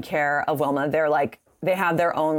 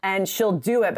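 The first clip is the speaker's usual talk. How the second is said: ordinary talk, nothing marked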